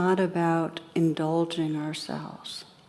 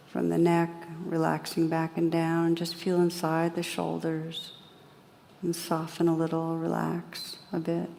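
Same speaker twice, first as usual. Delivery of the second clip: calm, unhurried guidance with long pauses; guided meditation; 3 dB quieter than usual. Real, not acted. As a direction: gently lead listeners inward, leaving space after each phrase.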